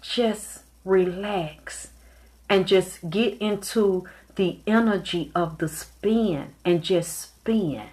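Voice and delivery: soft, slow, spaced-out phrases; soothing coaching. Retold or imitated. Retelling Michael's advice on how to spin, softly soothing. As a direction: gently ease someone into relaxing.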